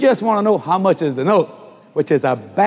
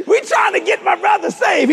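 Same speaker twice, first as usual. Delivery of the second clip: laughing; 13 semitones higher than usual. Real, not acted. Laughing preacher punchline.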